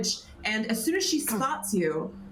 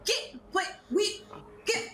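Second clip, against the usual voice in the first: villager voice; 3 semitones higher than usual. Acted. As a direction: beckon the strangers eagerly, fast. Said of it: "She says".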